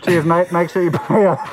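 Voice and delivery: muffled behind a rubber mask; talking through mask. Real, not acted. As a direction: speak through a latex face mask. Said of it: "It's hard to hear you with the mask on".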